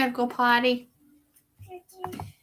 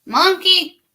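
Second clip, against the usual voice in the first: calling out; 9 semitones higher, 8 dB louder than usual. Real, not acted. Calling to baby.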